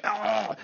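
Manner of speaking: sudden high yelp of neck pain; a sharp high "Ah!" from a stiff neck. Retold or imitated. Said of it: "you're like".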